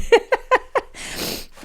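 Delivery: high breathy laugh through tears; teary laugh. Real, not acted. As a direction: laugh while crying. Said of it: "Dave told me it would".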